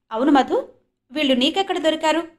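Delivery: fearless, mocking question; sarcastic. A narrator's reading. Jyoti asking Madhav sarcastically, fearless sarcasm. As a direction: needle him with sarcasm. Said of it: "నిర్భయంగా, వ్యంగ్యంగా అడిగింది".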